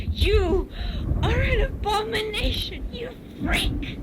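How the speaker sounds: furious mocking yell; shouted insult. A narrator's reading. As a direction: hurl it at him. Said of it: "she shouted".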